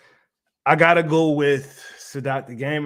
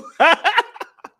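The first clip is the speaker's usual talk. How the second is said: sudden high laughing burst; 9 dB louder than usual. Real, not acted. Streamer laughing at mean chat, high laugh. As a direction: crack up high.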